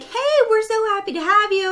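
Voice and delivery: high, loud, over-friendly greeting; chirpy, high-pitched hello; gushing welcome. Retold or imitated. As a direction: imitate an effusive charge nurse. Charge nurse's welcome, acted.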